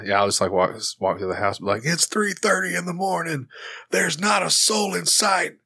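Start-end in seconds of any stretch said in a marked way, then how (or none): 1.8-5.6 high, hollered lyric recital; Steve Austin. Shown as a demonstration. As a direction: holler song lyrics like a wrestler's promo. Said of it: "I talk through songs as Steve Austin".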